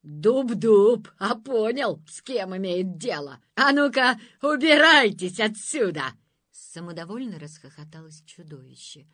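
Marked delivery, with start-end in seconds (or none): 0.0-6.0 very loud furious shout; birch lashing out. A narrator's reading. Birch soul attacking the monster, yelling. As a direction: lay into the monster. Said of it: "накинулась душа берёзы".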